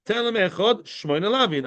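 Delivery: ordinary talk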